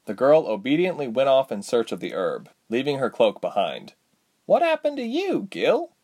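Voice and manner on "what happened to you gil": wondering heroine. Acted. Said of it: "Reshma wondered to herself".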